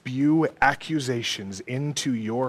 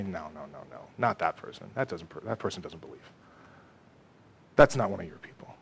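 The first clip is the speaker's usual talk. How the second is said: hushed, low, quick accusations; Satan as accuser; 8 semitones lower, 9 dB quieter, faster than usual. Acted. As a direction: murmur accusations confidentially to a judge. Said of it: "go to the Lord and say".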